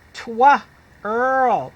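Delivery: "Twa" then "earl" split apart and loud; sounding out. Shown as a demonstration. Teacher sounding out twirl piece by piece. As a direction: break twirl into two loud parts. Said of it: "Say it with me".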